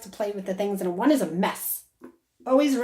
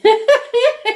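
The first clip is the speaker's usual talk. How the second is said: loud high laugh bursting out; real laugh; burst of laughter; 12 dB louder than usual. Real, not acted. Psychic reading, laughing at joke.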